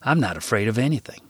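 usual speaking voice

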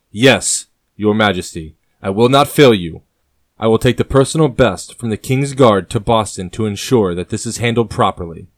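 ordinary delivery

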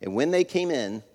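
usual speaking voice

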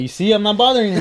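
overly sweet mock reassurance; teasing fake friendliness. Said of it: "And I'm like".

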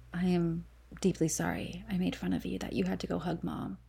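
hushed mock apology; whisper. Whispering a fake apology to her triplet sister.

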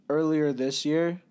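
ordinary delivery

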